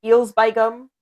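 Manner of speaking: hearty put-on Yorkshire accent. Shown as a demonstration. Echoing the catchphrase, loud Yorkshire accent. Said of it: "You gotta say".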